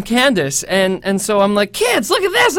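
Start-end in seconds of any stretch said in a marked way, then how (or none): 1.7-2.6 put-on high, excited call to the kids. Mother calling her kids over to the screen, excited. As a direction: call the children over, thrilled.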